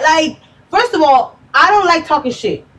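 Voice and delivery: usual speaking voice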